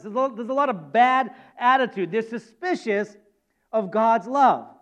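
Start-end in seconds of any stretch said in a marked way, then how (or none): none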